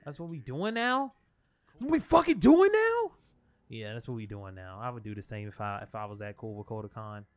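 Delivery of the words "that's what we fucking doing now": loud, much higher, outraged repeat of the question; outraged shout. Real, not acted. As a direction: yell the question in indignant disbelief.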